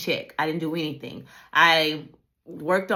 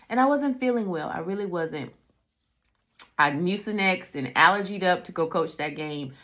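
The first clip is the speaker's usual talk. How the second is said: ordinary talk, nothing marked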